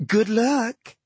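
sweet, high, lilting lady's voice; smitten hippo goddess. A narrator's reading. Hippo goddess answers the dwarf with delight, fond coo.

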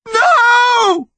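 high, anguished no; voice breaking. A narrator's reading. Connor refusing the yew tree, voice-breaking cry. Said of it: "his voice breaking".

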